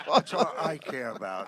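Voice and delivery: laughing through the words; cracking up at his own joke. Real, not acted.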